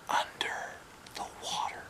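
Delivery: hushed whisper, voice dropped to breath; spooky whisper. Acted. Boy whispering a scary secret to newcomers.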